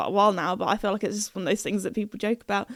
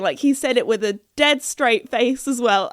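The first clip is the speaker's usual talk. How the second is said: normal talking voice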